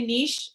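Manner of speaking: niche rhyming with leash; niche as "neesh". Shown as a demonstration. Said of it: "I'm gonna say niche".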